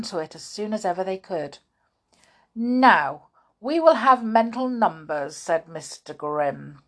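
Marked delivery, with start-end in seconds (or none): 2.5-5.4 loud, high classroom announcement; stern schoolmaster. A narrator's reading. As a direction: take command of the class. Grimm addressing the class.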